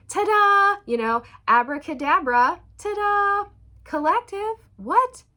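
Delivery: high, loud showy magician reveal; hammed-up magician flourish; playful ta-da. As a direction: announce it like a stage trick.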